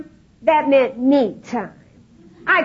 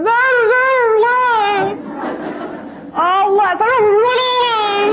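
crying wail; 10 semitones higher than usual. Retold or imitated. Wife sobbing out her confession to her husband, high tearful wail. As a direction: blubber it out while crying.

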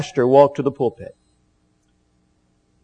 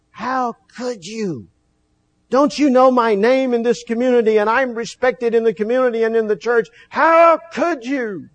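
retold angry father; 6 semitones higher than usual. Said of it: "in his anger".

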